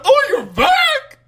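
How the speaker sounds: very high, loud, drawn-out squeal; mock excited greeting. Playing the ghost greeting the returning tenant, mock excited squeal. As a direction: squeal a hammed-up welcome, stretching the words.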